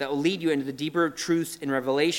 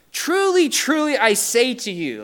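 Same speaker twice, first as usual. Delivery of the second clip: high, loud, slow solemn declaration; Jesus declaring to Nicodemus, loud and slow; Jesus proclaiming; 7 semitones higher, 7 dB louder than usual. Retold or imitated. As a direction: ring it out like a proclamation. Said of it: "He's saying".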